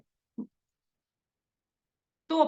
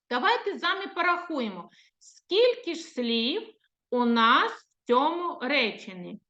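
ordinary delivery

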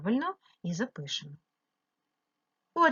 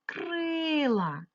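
Крила with a long, high и; Крила, first syllable held high; stressed check word; 6 semitones higher than usual. Shown as a demonstration. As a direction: draw out the stressed КРИ in Крила. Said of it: "Можу підібрати".

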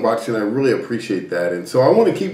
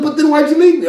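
very high, louder mock demand; imitated insistent reproach; 5 dB louder than usual. Wife pressing husband who left her in the water, mock indignant.

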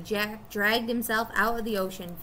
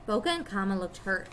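ordinary delivery

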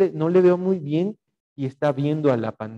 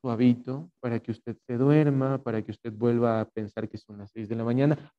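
low, hushed lullaby tone; soft and soothing, sinking lower; soft, sleepy demo voice; 3 semitones lower, 8 dB quieter than usual. Shown as a demonstration. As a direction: speak softly as if lulling someone to sleep. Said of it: "¿A qué me refiero con el tono de voz?".

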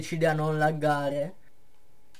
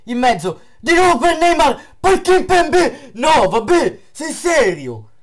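excited commentary; 8 semitones higher, 9 dB louder than usual. Real, not acted. Attack then disbelief.

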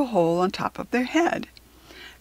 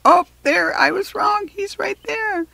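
high, bright discovery; delighted surprise; 13 semitones higher, 4 dB louder than usual. Real, not acted. Finding the penguin in the spotlight, delighted and much higher. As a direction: squeal it out as you spot him. Finds penguin after mistake.

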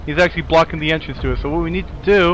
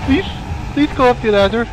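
desperate plea; 7 semitones higher than usual. Real, not acted. Begging while fleeing.

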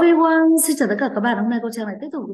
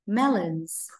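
model word; much slower than usual. Shown as a demonstration. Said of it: "đọc là melons".

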